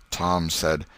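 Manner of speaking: ordinary delivery, nothing marked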